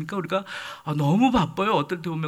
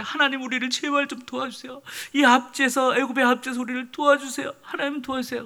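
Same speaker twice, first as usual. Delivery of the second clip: higher-pitched, imitated desperate plea; pleading prayer; 6 semitones higher than usual. Oppressed Israelites begging God for rescue, pleading. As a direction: cry out for help, acting the suffering people. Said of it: "신음하고 고통할 때".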